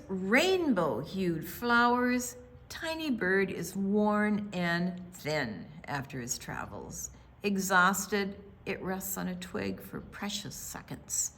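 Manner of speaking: ordinary delivery, nothing marked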